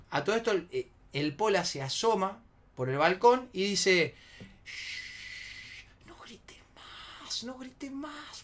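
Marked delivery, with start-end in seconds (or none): 4.6-8.4 very soft, slow drawn-out whisper; retold pleading whisper; hushing whisper. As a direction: hush them secretly from a balcony. Friend hushes them from balcony.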